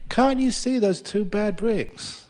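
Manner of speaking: slow, emphatic "can't you see?" appeal; incredulous. Retold or imitated. Monk asking the admiring visitor about his flawed wall, incredulous.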